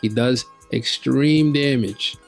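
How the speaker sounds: ordinary talk, nothing marked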